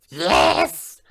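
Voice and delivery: high, very loud yell; talking coin shouting. Acted.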